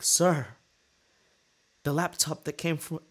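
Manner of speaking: high, mock-tearful plea; badly faked near-tears appeal; trying to cry. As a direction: plead high as if about to cry. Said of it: "I'm trying to cry so he cares more".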